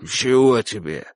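slow, husky husband; husky male voice. A narrator's reading. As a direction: answer slowly, like a man just woken. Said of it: "сиплый мужской голос". (сиплый мужской голос)

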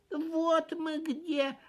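high, slow, tiny ant voice; thin little voice. A narrator's reading. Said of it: "отвечал тоненький голосок".

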